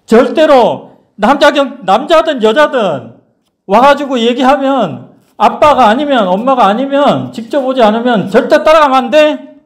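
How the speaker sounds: high, insistent retold instruction; emphatic warning. Adult warning children never to follow strangers, emphatically.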